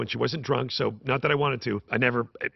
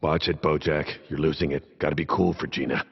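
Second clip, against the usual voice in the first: ordinary delivery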